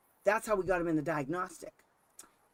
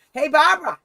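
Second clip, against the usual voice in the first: loud, raised-pitch call across a room; 6 semitones higher, 11 dB louder than usual. Retold or imitated. Sitting at the table calling Barbara at the door, calling out. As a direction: holler to someone leaving.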